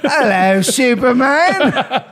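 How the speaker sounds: high, drawn-out mock British greeting; a hammed-up British lady voice; British accent. Friends riffing on the comic cover, in a British accent.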